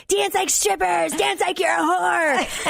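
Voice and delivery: mock drunken shout at the crowd; rowdy shouted order. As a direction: yell it like a tipsy MC. Quoting rowdy host's order.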